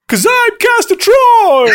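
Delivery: high, drawn-out manic shout; Castor Troy. Acted. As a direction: yell it out like a gleeful film villain. Acts out film villain's line.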